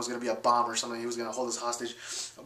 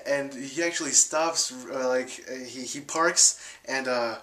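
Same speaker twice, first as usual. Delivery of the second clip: ordinary talk, nothing marked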